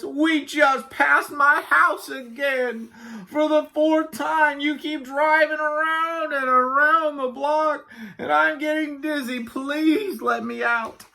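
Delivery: high, slow, drawn-out whine; sighing, pleading Walrus. A narrator's reading. Walrus begging Yak to let him out, plaintive. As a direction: drag out the plea wearily. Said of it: "sighed Walrus".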